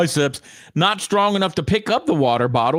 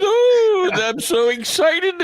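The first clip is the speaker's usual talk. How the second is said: mock gushing; 10 semitones higher, slower than usual. Mocking gushing host greeting.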